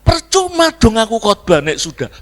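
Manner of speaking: high, loud exasperated retort; mock indignation. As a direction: give up on them in a huff. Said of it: "Abu Nawas gebrak meja". (kesal dibuat-buat, suara tinggi lantang)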